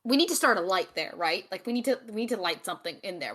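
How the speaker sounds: ordinary talk, nothing marked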